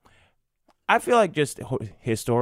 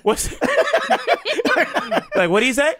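laughing; 6 dB louder than usual. Real, not acted. Laughing through question.

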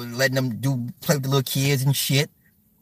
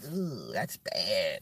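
low, soft, drawn-out groan; cringing; 6 semitones lower, 11 dB quieter, much slower than usual. Retold or imitated. Streamer still bothered twenty years later, cringing. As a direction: groan it slowly under your breath.

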